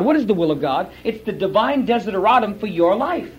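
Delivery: ordinary talk, nothing marked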